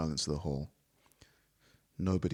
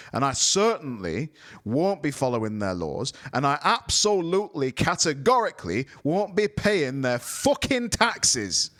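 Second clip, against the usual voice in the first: much higher and louder, slowing on the last words; heavy finish; 8 semitones higher, 6 dB louder than usual. A narrator's reading. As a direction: hammer each word home. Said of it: "he finished heavily".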